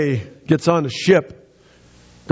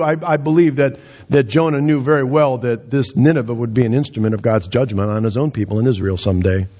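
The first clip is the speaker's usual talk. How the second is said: ordinary delivery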